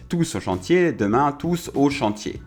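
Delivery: ordinary talk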